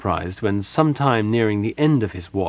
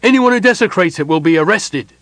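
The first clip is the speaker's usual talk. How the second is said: loud, raised commanding voice; stern loud warning; 9 semitones higher, 7 dB louder than usual. A narrator's reading. Gerard warning the surging crowd, loud and stern. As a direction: lay down the law to the crowd.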